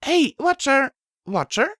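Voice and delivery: loud high call, then questioning. A narrator's reading. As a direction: shout his name, then wonder. Calling robot, then puzzled.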